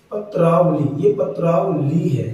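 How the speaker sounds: पत्रावली said loud and slow, every syllable clear; emphatic model word. Shown as a demonstration. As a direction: hit पत्रावली hard and slowly for the class. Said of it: "हमें बोलना है पत्रावली".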